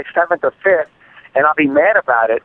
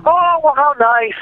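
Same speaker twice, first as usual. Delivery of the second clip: mocking complacent shrug; 11 semitones higher, slower than usual. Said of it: "I don't have that ... mentality".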